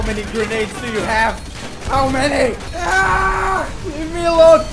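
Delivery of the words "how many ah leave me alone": high, loud frustrated yell; exasperated screaming. Real, not acted. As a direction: scream at the endless grenades. Swarmed by grenades.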